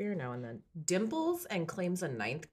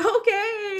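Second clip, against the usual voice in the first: high, loud put-on "okay"; mock hesitant agreement; 9 dB louder than usual. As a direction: agree in a much higher, louder voice. Acting out followers' reluctant answer.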